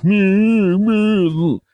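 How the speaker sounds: high, loud taunting meows; hammed-up mock wailing. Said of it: "like they're mocking him".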